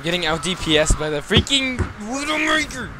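frustrated yell. Real, not acted. Frustrated at enemy sniper.

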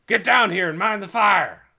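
loud, high, bellowed order; shouted command. A narrator's reading. Trog ordering Scargo to mind the fire, shout. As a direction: bellow it up to the house. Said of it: "he shouted".

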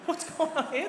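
high, slow bafflement; hammed-up wonder in a high voice; mind blown.

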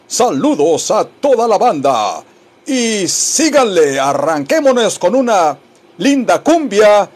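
showy cumbia DJ patter with stretched words; radio announcer. Acted. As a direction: announce it to the whole nation, building to the song. Plays a radio station announcer.

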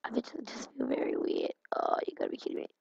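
soft unvoiced whisper. Real, not acted. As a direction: whisper it to yourself.

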